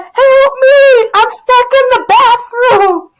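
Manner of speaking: high, loud wail with drawn-out words; retold desperate yell. Trapped teacher yelling through the door, hammed-up wail. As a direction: holler for rescue, hammed up. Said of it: "I was yelling and crying".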